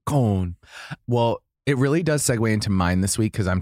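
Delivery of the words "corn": short British "Corn", no R; British accent. Shown as a demonstration. Host repeats the word for cohost, British accent. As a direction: say it once more in British. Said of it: "British is".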